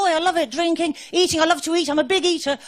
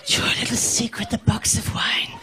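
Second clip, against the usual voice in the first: hushed, secretive aside; conspiratorial whisper; 3 dB quieter than usual. Acted. Comic confiding to the crowd about boxed wine, whispered.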